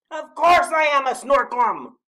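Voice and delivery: very high, loud protest; indignant bellow. A narrator's reading. Snorklum insisting to doubting Badger, bellowing. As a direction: bellow it as an affronted monster. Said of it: "bellowed the Snorklum".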